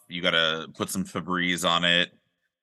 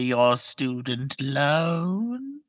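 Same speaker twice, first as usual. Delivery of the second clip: high, very slow crone drawl; old witch voice; 4 semitones higher, much slower than usual. Pretend. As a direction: draw it out like a hag. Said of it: "pretend like I'm the old witch".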